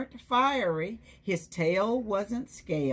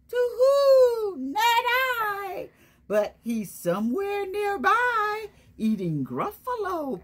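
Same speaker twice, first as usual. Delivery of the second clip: much higher, hooting owl voice; 4 dB louder than usual. Acted. The owl answering the Gruffalo's child, hooting high. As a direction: hoot it out like a wise old owl in a tree.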